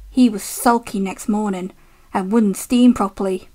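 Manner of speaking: ordinary delivery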